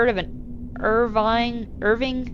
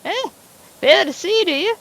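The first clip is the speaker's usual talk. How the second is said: put-on a loud, higher-pitched cantankerous old farmer; gruff old farmer; 8 semitones higher, 5 dB louder than usual. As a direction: play the surly old landowner, talking down to the neighbor. Plays Mister Morgan.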